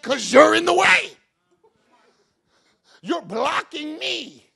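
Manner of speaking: loud, then slow on you're blocking me; God's rebuke. Retold or imitated. Preacher voicing God rebuking complaining Christians, loud. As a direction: hammer it home.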